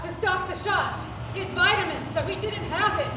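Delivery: high, loud and urgent; loud accusation. Acted. Leader accusing Alice of forgetting the shot, loud and angry. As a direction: hurl the blame at her.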